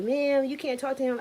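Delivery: a hint of a nurse telling her off; lightly voiced nurse. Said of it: "it was like".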